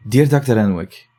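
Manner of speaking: ordinary delivery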